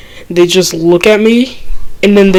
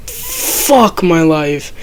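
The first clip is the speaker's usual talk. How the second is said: drawn-out despair; 4 dB quieter, much slower than usual. Retold or imitated. Dreading being called snitch.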